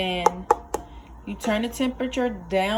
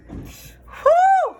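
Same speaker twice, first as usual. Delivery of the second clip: loud high whew; 6 dB louder than usual. Real, not acted. Onion fumes overwhelm her.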